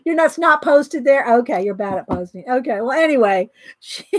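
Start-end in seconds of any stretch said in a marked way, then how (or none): none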